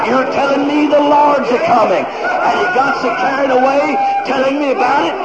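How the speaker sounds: loud, high-pitched revival shouting; full-force preaching. Real, not acted.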